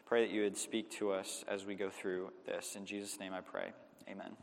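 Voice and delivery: low, subdued public prayer. Real, not acted. Pastor asking God to speak through the evening's text, prayerful. Closing an opening prayer before preaching.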